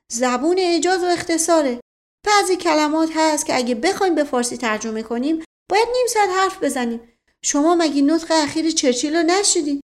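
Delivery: hurried, insistent explaining; hasty protest. A narrator's reading. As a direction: rush to defend yourself, pitch lifted. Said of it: "با عجله گفت".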